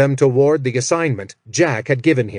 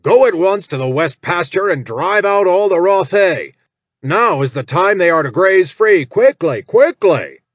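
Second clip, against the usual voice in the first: higher, louder overseer orders; commanding shout; 6 semitones higher, 5 dB louder than usual. Acted. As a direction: drive him off with shouted orders. Jack as Malmore commands gnoll.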